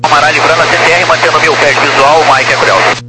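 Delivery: quick tinny radio readback; fast clipped radio transmission; sci fi communicator. Real, not acted. Pilot readback over the radio. (leitura rápida pelo rádio)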